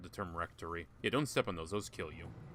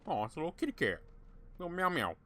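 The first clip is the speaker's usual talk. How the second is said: cooing at a kitty; 8 semitones higher, faster than usual. Real, not acted. Player spots a cat in the game, high cooing baby talk.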